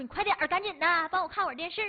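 mock motherly urging; urgent nagging. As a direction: hurry your daughter along. Mom urging daughter, retold.

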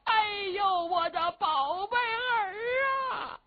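drawn-out, high-pitched mother's wail; theatrical keening old woman; loud weeping. Acted. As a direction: wail slowly for your darling child. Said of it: "老太太一听是放声痛哭啊".

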